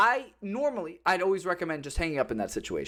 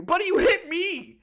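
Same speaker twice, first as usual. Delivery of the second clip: much higher, loud, drawn-out outrage; outraged shout; 12 semitones higher, 5 dB louder, slower than usual. Retold or imitated. Yelling at the driver who hit him, high and outraged. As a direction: yell it in disbelief.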